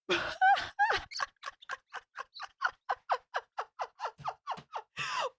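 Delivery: high, breathless giggling fit; helpless high laughter that keeps pulsing on; long laughing fit. Real, not acted. Vlogger cracks up reading her live comments, long high laughing fit.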